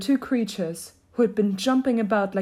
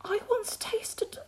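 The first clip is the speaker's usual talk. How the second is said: put-on high, soft, slow girl voice; soft high-voiced girl; Alice; 5 dB quieter, slower than usual. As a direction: begin slowly and softly, pitched high. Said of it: "Alice began to say".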